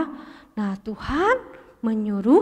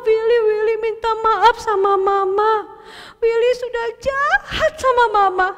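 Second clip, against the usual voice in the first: put-on high, loud tearful boy begging forgiveness; crying child's apology; 7 semitones higher, 4 dB louder than usual. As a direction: sob out the apology like a small boy. Willy apologises crying to mother. (anak menangis minta maaf, suara tinggi)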